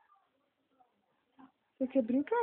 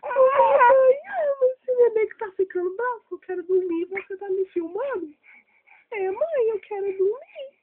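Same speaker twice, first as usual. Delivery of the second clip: voicing baby; 7 semitones higher, 9 dB louder than usual. Mother voices the sleepy baby.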